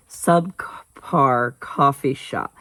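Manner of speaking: slow, halting attempt at SUBCAR; careful, drawn-out tongue-twister try. Shown as a demonstration. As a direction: pick through SUBCAR COFFEE SHOP syllable by syllable. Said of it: "I can say it fast, just not slow".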